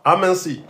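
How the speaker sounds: aminci, waw said thin and light; light thin vowel. Shown as a demonstration. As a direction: keep the waw light and unemphatic. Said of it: "le waw est aminci".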